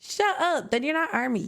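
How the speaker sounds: ordinary talk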